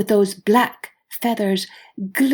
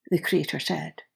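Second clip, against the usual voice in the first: ordinary delivery, nothing marked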